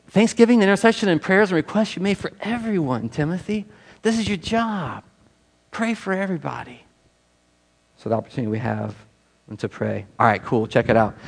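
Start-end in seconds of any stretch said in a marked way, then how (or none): 0.1-6.8 lightly voiced apostle, higher and softer; a hint of Paul coaching young Timothy; Paul urging Timothy, higher and gentle. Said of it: "Paul's like".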